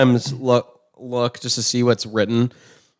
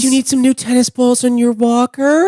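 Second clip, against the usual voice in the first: condescending elder talk; 10 semitones higher than usual. Retold or imitated. Mocking talking down to elders.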